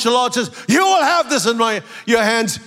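normal talking voice

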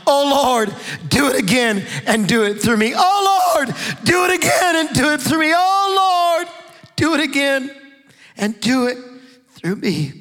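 retold young man crying out to God. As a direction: cry out to God, pleading. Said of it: "he was crying out".

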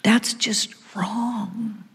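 slow inner whisper; whispered thought. Retold or imitated. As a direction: breathe it to yourself, slowly. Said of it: "I'm thinking".

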